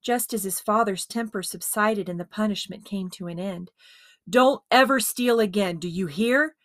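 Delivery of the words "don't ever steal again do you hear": stern warning. A narrator's reading. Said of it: "to emphasize each word".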